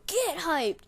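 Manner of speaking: high, loud rallying yell; retold screaming hype cry. Cheering every good play by the team, high yell. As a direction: re-enact yelling to pump up teammates. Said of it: "started screaming as loud as I could".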